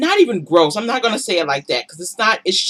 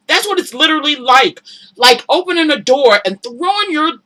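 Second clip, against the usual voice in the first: normal talking voice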